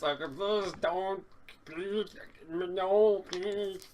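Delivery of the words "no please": ordinary delivery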